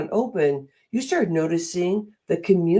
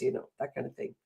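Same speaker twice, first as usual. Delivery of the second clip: normal talking voice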